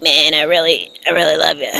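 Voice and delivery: low, soft Kermit-like voice; mock Kermit-style sincerity, low and quiet; Patrick Mahomes impression. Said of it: "I can't even do that voice".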